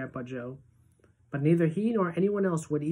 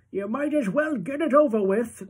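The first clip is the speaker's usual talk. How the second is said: put-on high, louder old-man voice; old grandpa voice; 8 semitones higher, 5 dB louder than usual. A narrator's reading. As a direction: push him gently to get it done.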